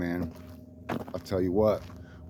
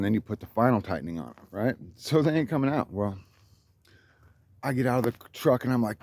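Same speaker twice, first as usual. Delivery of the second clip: usual speaking voice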